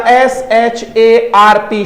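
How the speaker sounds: letter by letter, slowly; spelled slowly. Shown as a demonstration. Teacher spelling SHARP slowly for students. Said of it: "और word लीजिएगा".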